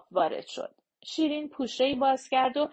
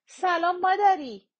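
a bright greeting, higher and louder; 8 semitones higher, 5 dB louder than usual. A narrator's reading. Girl greeting her grandmother from the car, loudly. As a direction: call hello to grandma. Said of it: "بلند گفت".

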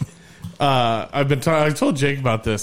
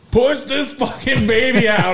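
much higher, louder and slow, a hammed-up shout; mock yell at wife; 12 semitones higher, 4 dB louder than usual. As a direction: bark it at her like a panicked husband. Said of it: "grab my wife's head and be like".

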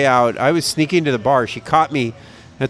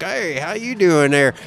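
put-on friendly country Illinois accent, pitched up; rural Illinois accent; 4 semitones higher than usual. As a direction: do the small-town Illinois garage guy accent. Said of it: "sorry about the accent".

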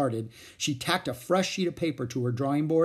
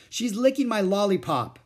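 put-on higher, louder boy complaining; loud tattling; 8 semitones higher, 5 dB louder than usual. A narrator's reading. Boy loudly tattling on Ramona. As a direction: tattle loud enough for teacher. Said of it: "in a loud voice".

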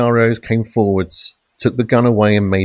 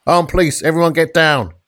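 police shout; 8 semitones higher than usual. A narrator's reading. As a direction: yell it as you charge in. Officers storming flat, shouting.

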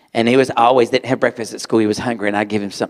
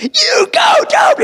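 much higher, louder triumphant cheer; cheering shout; 5 dB louder, slower than usual. Retold or imitated. Tutor cheering Dobie's first reading, high shout. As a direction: yell it like he just won gold. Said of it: "I was like".

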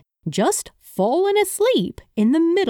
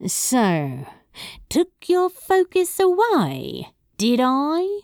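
tempting tease; 6 semitones higher than usual. A narrator's reading. Crowley out to fluster Aziraphale, teasingly tempting. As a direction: lay temptation on the words, coyly. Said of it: "He let temptation slip into his words".

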